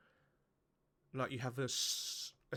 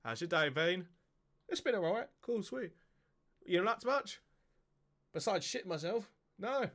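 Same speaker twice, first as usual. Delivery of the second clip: ghost small talk; 6 semitones higher, 3 dB louder than usual. Retold or imitated. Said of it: "just a dude standing there like".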